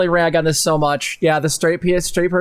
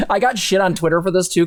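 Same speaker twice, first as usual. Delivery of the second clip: ordinary talk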